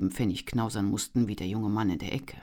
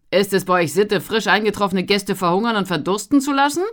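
loud, higher mock complaint; loud mocking call; 7 semitones higher, 10 dB louder than usual. A narrator's reading. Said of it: "Auf diesen Ruf hin".

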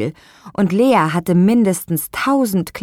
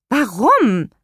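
defiant; 7 semitones higher, 4 dB louder than usual. Acted. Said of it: "fragte Lea beinahe trotzig".

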